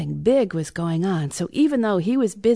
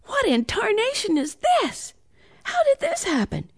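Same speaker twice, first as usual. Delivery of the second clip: put-on high-pitched boy's amazement; wide-eyed disbelief, pitched way up; astonished; 13 semitones higher than usual. A narrator's reading. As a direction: exclaim it high, utterly taken aback. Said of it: "Ian's eyes practically popped out".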